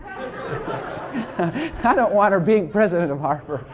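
slow, drawn-out words through laughter; amused, laughing mock objection. Real, not acted. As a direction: joke through your own chuckles. Joking about his wife, laughing.

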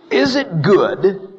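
loud, high, slow challenge; acted bold accusation; accusing. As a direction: put God on trial with each drawn-out word. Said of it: "He's accusing God".

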